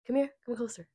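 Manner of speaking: "Come closer" sinks to a soft mock whisper; mock beckoning whisper. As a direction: draw him in with a hushed, mock-secret call. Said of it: "I said".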